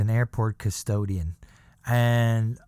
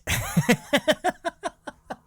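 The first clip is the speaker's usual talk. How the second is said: laughing; 4 dB louder than usual. Real, not acted. Laughing mid quote.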